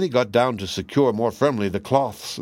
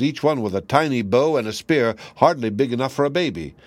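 plain reading voice

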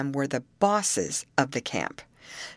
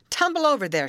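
high, raised command; directing; 10 semitones higher, 4 dB louder, faster than usual. A narrator's reading. Rescue leader sending his men to the shack, directing loudly. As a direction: call an order out to the men. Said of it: "directed Mister Hammond to his men".

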